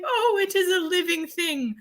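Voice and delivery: high-pitched awed dog voice; Porto. Pretend. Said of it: "he was like".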